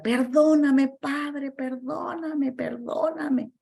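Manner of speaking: high, slow, pleading mock sob; retold crying plea. Retelling the man begging the Father's pardon, in a half-acted sob. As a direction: echo the man's broken cry for forgiveness. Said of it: "empezó a gritar, a llorar".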